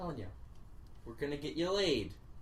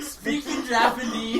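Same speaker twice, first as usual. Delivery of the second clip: announcer voice; 6 semitones higher, 5 dB louder, slower than usual. Acted. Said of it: "I'm gonna translate for you".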